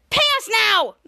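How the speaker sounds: high, loud squeaky creature demand; demanding mole queen. Acted. Mole queen demands payment from the heroes, high and loud. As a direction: bark the order like a greedy little queen.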